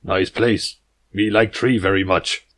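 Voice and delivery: chest-thumping jungle hero, terse and loud; jungle stallion talk. Acted. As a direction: puff up and speak in blunt caveman words. Balrus admires the library.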